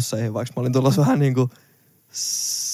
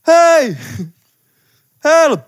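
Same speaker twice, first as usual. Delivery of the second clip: high, loud call for help; retold desperate shout, much higher and louder; 7 dB louder than usual. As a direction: yell across to strangers for help. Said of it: "sit mä vaan huudan jollekin pariskunnalle".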